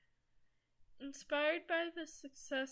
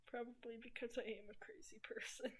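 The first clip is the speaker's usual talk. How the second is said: whispering; 9 dB quieter, faster than usual. Real, not acted. Whispered aside while playing.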